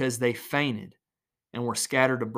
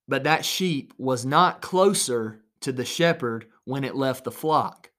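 ordinary talk

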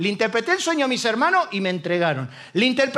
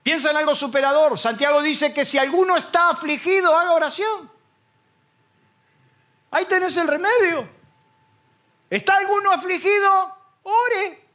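raised, fervent preacher voice; preaching; 4 semitones higher, slower than usual. Acted. Pastor preaching to the church, fervent. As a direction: preach it out to the congregation.

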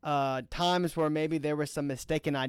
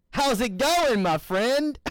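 high, loud, playful callback; teasing greeting; 9 semitones higher, 4 dB louder, slower than usual. Acted. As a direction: greet a friend with a knowing tease.

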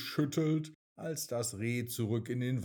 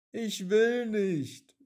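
higher, louder, slow stubborn raindrop; whiny raindrop; 10 semitones higher, 7 dB louder, slower than usual. A narrator's reading. Raindrop refuses the puddle, high and whiny. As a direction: wail the refusal like a small child.

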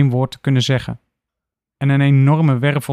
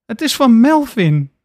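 tearful; 10 semitones higher, 4 dB louder than usual. Acted. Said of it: "opnieuw in huilen uitbarst".

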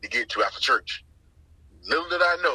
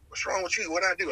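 put-on girl's hurt plea; 7 semitones higher than usual. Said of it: "she's like".

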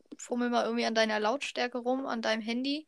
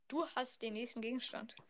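usual speaking voice

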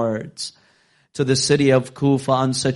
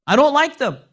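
master's complaint thrown up high and louder; 13 semitones higher, 5 dB louder than usual. Retold or imitated. Master refusing the dates, high and louder. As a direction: snap it back at the slave girl.

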